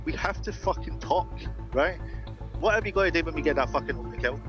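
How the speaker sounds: ordinary talk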